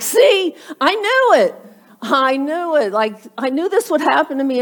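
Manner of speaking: loud, high I-told-you-so; mock indignant outburst. Regretful giver after car breaks down, mock indignant. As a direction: burst out high, then ease down.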